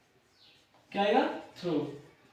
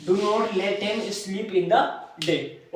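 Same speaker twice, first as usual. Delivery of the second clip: very slow, word by word; teacher dictating to the class; slow dictation; much slower than usual. Shown as a demonstration. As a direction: dictate so the class can write it down. Teacher dictates sentence to students.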